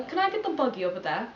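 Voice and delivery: badly faked English accent; terrible English accent. Asking for the buggy, terrible mock English accent. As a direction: try a clumsy English accent. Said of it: "That was a terrible accent".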